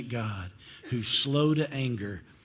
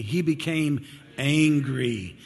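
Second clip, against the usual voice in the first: slow, drawn-out emphasis on angry; leading congregational response; slower than usual. Shown as a demonstration. Preacher leads congregation in saying it, slow and emphatic. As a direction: stretch it out for the crowd. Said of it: "Everybody say this with me".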